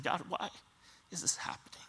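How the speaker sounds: a quiet, aching whisper; hushed plea. Retold or imitated. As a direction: breathe it out as a broken whisper. Imagined grieving prayer to God.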